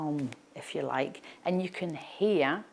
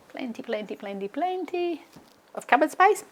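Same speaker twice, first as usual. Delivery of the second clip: playful rising sing-song; 8 semitones higher than usual. Real, not acted.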